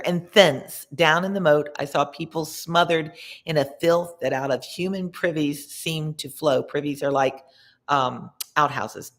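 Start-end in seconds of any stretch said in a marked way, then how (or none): none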